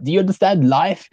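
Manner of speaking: Johnny impression. Retelling Johnny's line.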